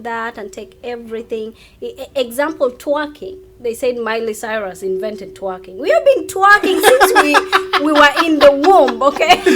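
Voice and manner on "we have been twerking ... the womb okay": laughing. Real, not acted. Laughing boast about twerking.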